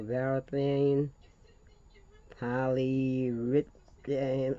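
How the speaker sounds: Varathane, polyurethane drawn out syllable by syllable; struggling to get the product names out; careful slow pronunciation. Real, not acted. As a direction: sound out Varathane and polyurethane very slowly. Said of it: "Don't ask me to pronounce it".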